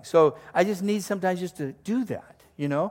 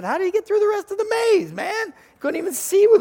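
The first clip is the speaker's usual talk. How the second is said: much higher, loud, incredulous; excited high voice; 7 dB louder than usual. Retold or imitated. Husband on the phone to wife, high and excited. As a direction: squeak out the question in amused disbelief. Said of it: "I'm talking like this to Annette".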